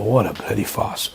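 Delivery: low, soft aside; muttered inner thought. Retold or imitated. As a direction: drop right down as if thinking it privately. Private thought during the call.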